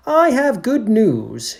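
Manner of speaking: high, loud, slow announcement; Detective McGarrigan. Acted. Detective enters to announce good news, proclaiming. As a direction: proclaim it grandly.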